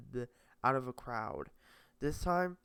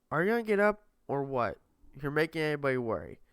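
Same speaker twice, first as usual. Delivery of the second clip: impatient; 3 semitones higher, 6 dB louder, much faster than usual. Acted. Maze impatient.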